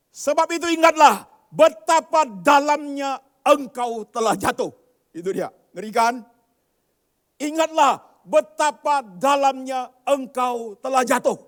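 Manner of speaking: slow, loud booming verse; thundering scripture. Acted. Preacher booming a Bible verse to the congregation. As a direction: proclaim it like a warning.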